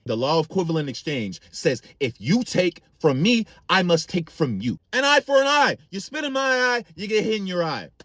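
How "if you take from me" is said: ordinary delivery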